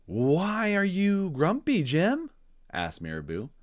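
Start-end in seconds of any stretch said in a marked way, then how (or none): 0.1-2.3 much higher, slow, sing-song bird; a chirpy curious bird asking. Acted. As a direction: reader voicing Marabou to children. Marabou voiced in read-aloud story.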